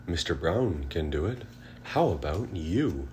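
ordinary delivery, nothing marked

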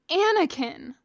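loud, sharp rebuke. A narrator's reading. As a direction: snap his name to stop him. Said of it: "The sharp tone".

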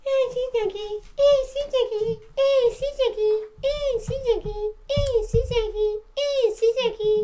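high-pitched mimicry of a friend; mock your friend in a high, repeating singsong; mocking imitation. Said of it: "That's what you sound like".

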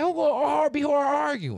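high, loud retold protest; comically outraged comeback; mock exasperated yell. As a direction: shout it back in high-pitched indignation. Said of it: "You pissing me off".

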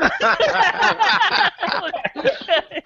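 high burst of laughter; real laughter at the memory; big laugh. Real, not acted. Laughing at his own story.

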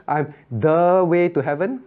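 slow, stretched "the" before "way to heaven"; emphasis on THE. Shown as a demonstration. Teacher counting the Lord's emphases, stressed THE. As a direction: lean long on "the". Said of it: "could the Lord emphasize".

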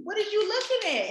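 high, sharp confrontational challenge; a hammed-up threat, eyes narrowed; mock angry.

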